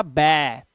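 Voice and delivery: "बै" drawn out with an open ae; "बै" as a long, clear demo syllable. Shown as a demonstration. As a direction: hold the vowel of "बै" like bat. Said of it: "जैसे ए लगा हुआ है".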